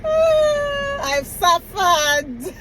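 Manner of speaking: high, drawn-out wail through laughter; comic lament, pitched far up and stretched; laughing wail. Real, not acted. Laughing about her struggle to be understood, mock wail.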